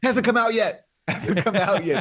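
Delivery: high, loud mock protest breaking into laughter; mocking jeer. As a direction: jeer it loudly, laughing between the repeats. Jeering echo of co-host's mocking line.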